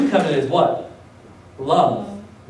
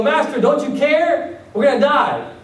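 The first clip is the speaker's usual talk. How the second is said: panicked disciples; 8 semitones higher than usual. Retold or imitated.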